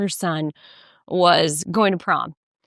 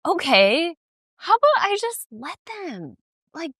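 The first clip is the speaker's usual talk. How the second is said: much higher light mother voice; calm mom; 9 semitones higher than usual. Retold or imitated. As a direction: talk yourself into letting go. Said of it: "she was like".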